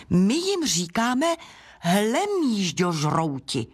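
slow, drawn-out disclosure; dramatic reveal. Acted. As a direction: stretch out the dreaded name. Snail reveals the dreaded name. (pomalé dramatické odhalení)

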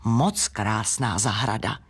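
low, slow man's voice; gentleman visitor. A narrator's reading. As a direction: appraise it slowly in a deep pitch. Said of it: "jeden druhému povídá". (hluboký hlas pána)

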